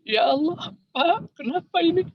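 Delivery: high, alarmed plea to her husband; wife crying out to her husband as the car slides, panicked and high; wife's panicked cry. Retold or imitated. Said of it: "hanya bilang begini".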